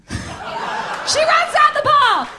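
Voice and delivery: very high, loud, drawn-out shriek; hammed-up wailing narration; screamed climax. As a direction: scream the line slowly in mock hysteria. Screamed climax of her joke.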